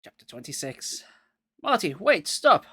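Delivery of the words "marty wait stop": loud, high shout while running; desperate shout after a friend. A narrator's reading. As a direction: yell at him to stop. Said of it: "I shouted as I ran".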